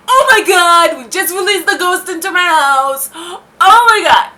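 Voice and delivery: loud, high-pitched panicked wail, re-enacted; hammed-up terrified outburst; panicked freak-out. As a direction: cry it out in dramatised horror. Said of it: "I'm freaking out".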